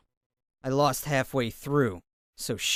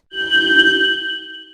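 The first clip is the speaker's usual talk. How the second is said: villain laugh; 9 dB louder than usual. Acted. Villain laugh in game clip.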